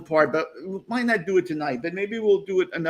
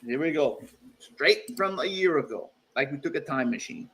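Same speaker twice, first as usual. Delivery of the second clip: normal talking voice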